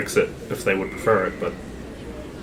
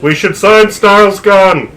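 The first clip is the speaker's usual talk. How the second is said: slow raised call from another room; yelling across the room; 10 semitones higher, 6 dB louder, slower than usual. Acted. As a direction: shout it down the hall from his room. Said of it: "Zala yells from his room".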